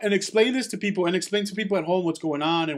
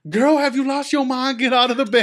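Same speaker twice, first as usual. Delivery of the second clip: higher, louder mock scolding of a girl; sassy telling-off; 5 semitones higher, 4 dB louder than usual. Two women next to me scolding a girl, sassy mock scolding. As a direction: imitate an outraged onlooker scolding someone. Acted telling-off by concertgoers behind.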